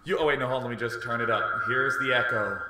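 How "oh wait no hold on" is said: normal talking voice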